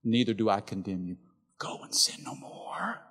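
soft, sinking to a whisper at the end; gentle Jesus. Acted. Jesus sparing the woman caught in adultery, tender hush. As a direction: forgive her tenderly, almost whispered. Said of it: "but a gentle spirit says".

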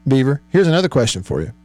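ordinary talk

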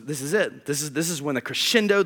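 ordinary delivery

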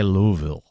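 Louisville squeezed to "Loo-vil"; attempted local pronunciation. Shown as a demonstration. Host admitting trouble with place names, Louisville as "Loo-vil". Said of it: "I can't say Louisville".

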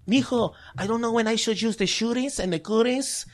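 a hint of mom's Mexican accent. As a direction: son imitating his mom's Mexican accent. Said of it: "my mom saying".

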